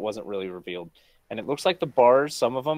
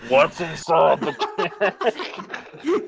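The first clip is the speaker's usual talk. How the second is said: mock yell; 6 semitones higher, 5 dB louder than usual. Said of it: "He yells into the mic".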